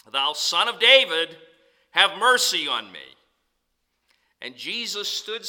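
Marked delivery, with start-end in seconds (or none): 0.1-3.0 loud pleading call; crying out loud. Retold or imitated. Preacher voices Bartimaeus crying to Jesus, loud plea. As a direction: call out for mercy over the crowd. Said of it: "He cries the more a great deal".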